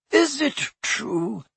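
high, slow breathless whisper; a shocked portrait whispering. A narrator's reading. The fat lady to Harry, whispering high. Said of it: "whispered the fat lady".

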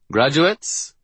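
a hint of a bored principal; lightly voiced dull principal. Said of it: "sounding as bored as ever".

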